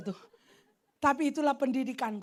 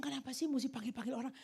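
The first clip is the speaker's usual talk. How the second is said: muttered complaint; 3 semitones lower, 11 dB quieter, faster than usual. Retold or imitated. Young Evelyn complaining to mentor.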